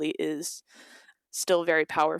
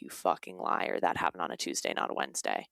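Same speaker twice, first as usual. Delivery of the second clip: low, growled, hushed mock accuser; low, quiet mocking sneer at the victim; mock angry accuser; 12 semitones lower, 5 dB quieter than usual. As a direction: drop deep and sneer like a troll. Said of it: "someone's like".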